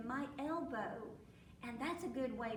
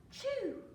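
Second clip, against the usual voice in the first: gasp, then high "choo"; mimed sneeze; 12 semitones higher, 4 dB louder than usual. A narrator's reading. Storyteller as the pig catching cold, mimed high sneeze. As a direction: act out the pig's sneeze. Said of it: "and the sneeze".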